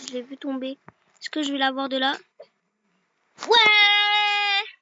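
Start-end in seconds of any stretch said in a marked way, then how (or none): 3.5-4.8 loud high yell of victory; triumphant cheer. Real, not acted. Player celebrating a hit on the grandmother, loud cheer. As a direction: shout it out like a win.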